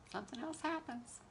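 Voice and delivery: quiet, lilting punchline; wry aside. Real, not acted. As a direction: lift the pitch and soften it. Repeats the line, trailing softer.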